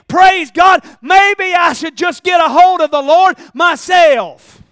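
loud rising preacher cry; fiery sermon climax; preaching at full force. Acted. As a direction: preach it out to the congregation.

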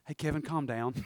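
very soft, low calming; soft-spoken. Acted. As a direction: settle him gently. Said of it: "so soft-spoken".

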